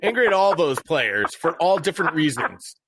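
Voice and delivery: high-pitched exasperated list of grievances; angry venting. Real, not acted. Fantasy owner blaming a list of players, angry venting. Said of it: "I'm also angry".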